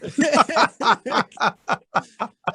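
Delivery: long, high, loud laugh; long amused laughter; real laugh. Real, not acted. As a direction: laugh hard at the memory. Laughs at frat house mic story.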